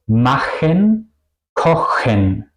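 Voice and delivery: CH like Spanish J in "machen, kochen"; jota española. Shown as a demonstration. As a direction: rasp the CH at the back in "machen, kochen". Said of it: "como la J española". ("machen, kochen" con ch como jota española)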